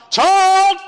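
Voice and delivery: acted parental outburst at full pitch; exasperated parent's yell. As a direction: patience gone, yell for the kid. Parent calling child, escalating.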